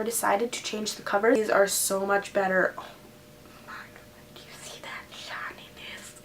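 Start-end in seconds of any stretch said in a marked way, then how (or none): 2.8-6.1 breathless whispered awe; awed whisper. Real, not acted. Vlogger showing viewers a shiny book cover, awed whisper. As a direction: whisper it like you've found treasure.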